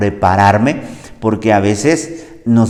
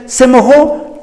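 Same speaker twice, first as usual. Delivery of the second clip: obvious question; 12 semitones higher, 4 dB louder, slower than usual. Retold or imitated. Family member asks soaked man.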